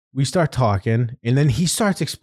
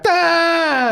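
high, drawn-out awkward "ah" laugh; 3 dB louder than usual. Retold or imitated.

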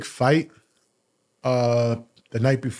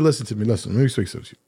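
low, hushed boss voice leaning in; boss getting serious; 5 semitones lower, faster than usual. Retold or imitated. General manager leaning in to lecture the moody salesman, low and hushed. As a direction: drop low and draw him close to listen. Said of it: "He said".

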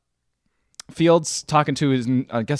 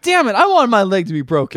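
frustrated quote; 7 semitones higher, 6 dB louder than usual. Retold or imitated. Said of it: "And he's like".

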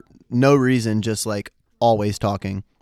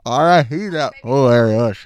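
normal talking voice

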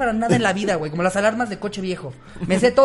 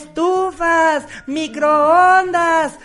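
high, loud, very slow scrap-buyer chant; singsong market price calls; 10 semitones higher, 6 dB louder, much slower than usual. Retold or imitated. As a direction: stretch each item like the scrap-buyer loudspeaker. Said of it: "gritos de vendedor te sabes". (pregón cantado del fierro viejo, lento y fuerte)

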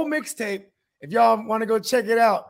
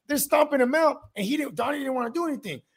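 usual speaking voice

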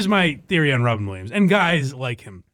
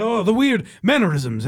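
Robin Williams impression; 6 semitones higher than usual. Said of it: "the speed of talking".

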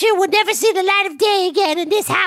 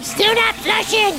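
calling out; 3 semitones higher, slower than usual. Acted. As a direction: call the bad news out slowly. Calling out from toilet.

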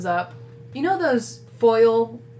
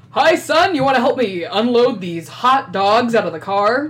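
cheery dad; 3 semitones higher, 7 dB louder than usual. Acted. Dad arriving with hot dogs, calling to son, louder. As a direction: boom a proud hello to your son.

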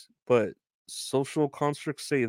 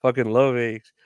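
normal talking voice

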